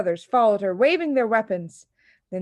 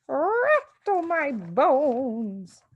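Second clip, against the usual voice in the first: slow, high, eerie drawn-out croak; haunt the room; soft spooky parrot; 7 semitones higher, 3 dB louder, much slower than usual. Acted. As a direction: parrot sounding like a ghost. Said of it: "soft and spooky this time".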